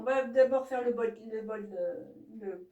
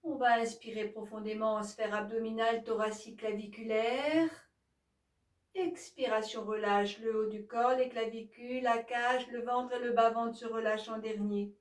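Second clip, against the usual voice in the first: calm, even yoga nidra guidance; softer, steady voice leading a body scan; guided relaxation; 2 semitones lower, 4 dB quieter than usual. Acted. Yoga teacher guiding listeners to release each body part, calm guided relaxation.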